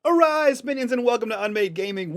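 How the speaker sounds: high, booming mock overlord greeting; villain host intro. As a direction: welcome the minions grandly. Channel intro call.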